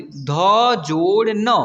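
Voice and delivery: letters joined slowly one by one; slow spelling out. Shown as a demonstration. Teacher sounding out letters for young learners, slowly. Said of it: "तो ऐसे पढ़ेंगे".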